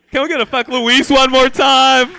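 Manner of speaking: loud high crowd chant call; rally the crowd loudly; crowd callout. Real, not acted. Calling out to crowd.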